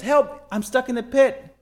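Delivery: high call from a pit; acted yelling up. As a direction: shout upward for rescue, voice pitched high. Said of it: "And he yells".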